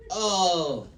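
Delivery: ordinary talk, nothing marked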